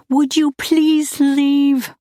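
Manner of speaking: slow, high, loud plea; drawn-out, high-pitched begging. A narrator's reading. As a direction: beg desperately, stretching the words. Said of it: "she begged him".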